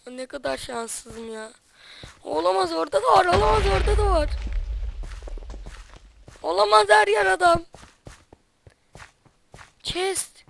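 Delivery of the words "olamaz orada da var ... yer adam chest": high, loud, frantic panic; yelling in terror while fleeing; panicked screaming. Real, not acted. Fleeing enemies in a game.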